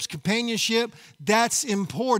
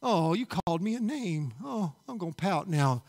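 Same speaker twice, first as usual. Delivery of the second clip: mock pouting; 4 semitones lower, 8 dB quieter than usual. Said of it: "we tend to pout".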